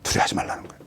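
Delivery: soft whisper after a pause; whispered reassurance. Real, not acted. Preacher telling the congregation not to fear, whispered. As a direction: drop to a whisper to calm them.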